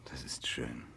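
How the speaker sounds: tender unvoiced whisper. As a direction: breathe it close to her ear. Intimate whispered love scene.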